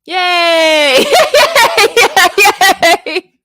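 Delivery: high, loud cheering; excited cheer. Real, not acted. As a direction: shout with joy. Cheering winning bid.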